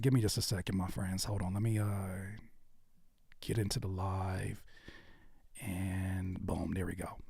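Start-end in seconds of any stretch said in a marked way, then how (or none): 1.4-7.2 whispered under the breath. Real, not acted. Streamer setting up the chat, whispering to himself. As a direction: mutter it to yourself while fixing the stream.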